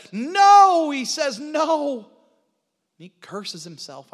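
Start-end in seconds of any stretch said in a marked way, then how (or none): 0.1-0.9 very high, full-force shout; shouted denial. Retold or imitated. Peter denying Jesus when accused, shouted denial. 1.4-2.0 high, loud cry of refusal; shouted denial. Retold or imitated. Peter refusing his accuser once more, shouted denial.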